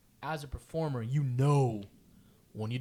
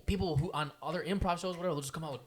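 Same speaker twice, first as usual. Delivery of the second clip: usual speaking voice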